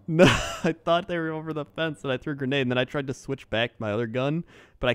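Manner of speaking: fast, loud excited explanation; excited rush. Real, not acted. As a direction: rattle it off excitedly.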